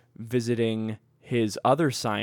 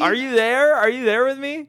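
excited urging; 12 semitones higher, 6 dB louder than usual. Real, not acted. Excitedly urging co-host to follow theory.

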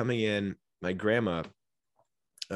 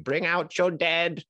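slow, higher, drawn-out calling of the dead; Monty Python crier, slow and high; mock town crier call; 9 semitones higher, slower than usual. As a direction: call it out slowly like a plague cart man. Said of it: "this man screaming".

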